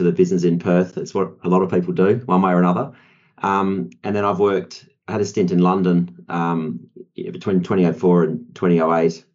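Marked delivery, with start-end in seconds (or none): none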